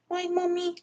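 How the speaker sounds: high, soft question to a pet; pet talk. Real, not acted. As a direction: ask it in a small high voice. Talking to the pet.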